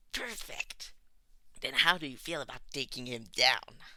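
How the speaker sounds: delighted, pounce on the idea; gleeful. A narrator's reading. Tomura grinning wide at Todoroki, gleeful. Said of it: "Tomura's grin widened".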